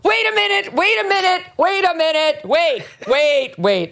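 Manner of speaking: high, mocking "wait a minute" stall; high mock stall, tapering down; mocking her stalling. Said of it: "Here she goes".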